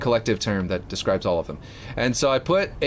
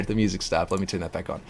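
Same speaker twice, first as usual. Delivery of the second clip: ordinary delivery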